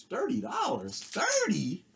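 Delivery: high-pitched mock disbelief; incredulous price check. Record collector reliving his shock at the price tag, high and incredulous. Acted reaction to seeing the price.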